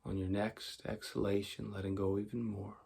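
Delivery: calm, unhurried meditation voice with long pauses; guided meditation. Acted. Teacher guiding tension and relaxation before sleep, calm meditation voice. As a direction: talk them softly into letting go.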